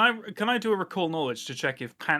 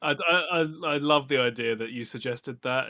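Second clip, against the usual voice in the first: normal talking voice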